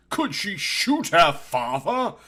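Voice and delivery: plain reading voice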